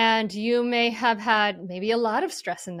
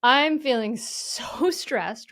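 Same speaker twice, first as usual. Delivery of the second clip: louder, emphatic mock overwhelm; stressed self-talk; 4 semitones higher, 6 dB louder, slower than usual. Parent admitting feelings to self in grocery store, acted stress.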